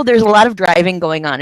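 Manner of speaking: ordinary talk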